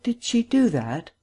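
high, slow woman's question; wondering, unsure whether it's a joke; uncertain surprise. A narrator's reading. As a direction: draw the question out, pitch well up. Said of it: "The woman regarded him uncertainly".

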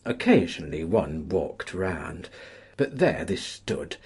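plain reading voice

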